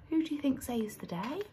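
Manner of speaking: ordinary delivery, nothing marked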